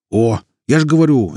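loud, high burst of recognition; excited. A narrator's reading. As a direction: blurt it out, pleased. Ghost reacts, recognising the helicopter.

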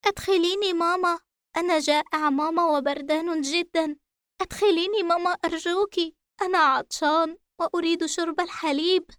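put-on high, pleading little boy; a scared child begging his mother again; frightened child voice. A narrator's reading. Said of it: "عاد الصوت من جديد يردد".

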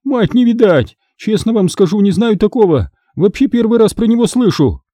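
high-pitched protest of ignorance; bewildered captive woman. Acted.

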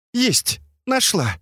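high, bright cry of success; excited find. Acted. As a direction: announce it with glee. Katya finds the light.

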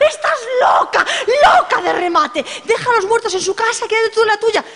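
very high, laughing shouted scolding. Acted. Friends scold the stubborn hostess, high laughing shout. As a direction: scold her in a high panicked yell.

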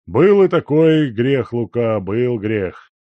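loud then slow, sheepish admission; rueful old miner. A narrator's reading. Guest admitting his drinking to the servant, loud then slow. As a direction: own up with a sigh.

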